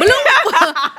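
silly bloop. Pretend. Silly sound effect play.

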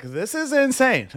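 high, slow, drawn-out disbelief; exasperated disbelief. Retold or imitated. Man venting about his date's demands, exasperated disbelief. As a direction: stretch it out high, at wit's end.

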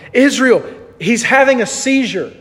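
mild mock scream of a panicked wife; retold terrified scream. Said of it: "My wife is screaming in terror".

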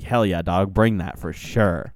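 a hint of an eager yes man cheering her on; mock bro hype. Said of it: "all your friends were like".

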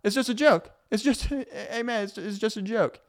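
high, breezy fake-innocent brush-off; mock passive aggressive. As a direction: play the jokey dodger laughing it off. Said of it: "they're like".

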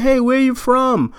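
high-pitched quoted question; curious New Yorker. Retold or imitated. New Yorker asks a visitor, high quoted voice. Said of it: "somebody was like".

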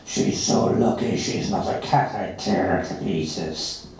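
put-on the cat silently threatens the girl, low and slow; menacing cat thought. A narrator's reading. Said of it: "I think".

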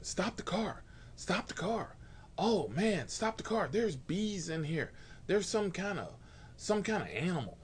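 high, panicky passenger yelling stop the car; mock scream. Passenger yelling at the driver to stop, high mock panic. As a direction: ham up a big man panicking over bees, pitched high. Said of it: "So he starts screaming".

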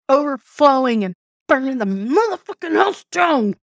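building to a high, heated outburst; mock explosion of rage; anger boiling over.

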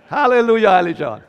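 laughing hallelujah to Ali; laughing cheer. Real, not acted. Preacher cheering Ali's answer, laughing.

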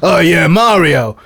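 hammed-up non-Italian Mario; American Mario. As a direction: play Mario as a clueless American dude. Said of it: "they were all just like".

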